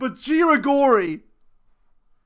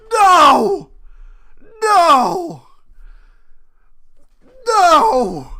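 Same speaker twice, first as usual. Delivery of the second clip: stunned joyful no; 6 semitones higher, 8 dB louder, much slower than usual. Real, not acted. Disbelief after the goal.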